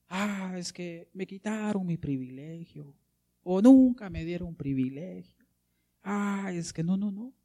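slow, drawn-out sulky parody; mock whining. Preacher parodies complaints about lost privileges, mock whining. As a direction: whine about imagined slights, mockingly. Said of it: "No dijo así Bartimeo".

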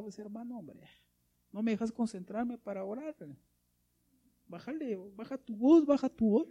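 high-pitched mock complaint; imitated annoyed shushing. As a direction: play a bothered worshipper hushing someone. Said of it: "y alguien le diga".

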